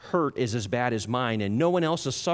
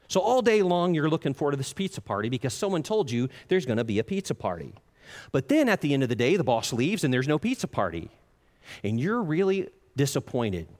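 ordinary delivery

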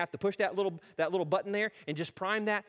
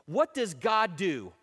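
loud, slow preacher's question; rallying challenge; 3 semitones higher, 6 dB louder, slower than usual. Acted. Man challenging his own discouragement, loud and rallying. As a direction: hit each word slowly and forcefully.

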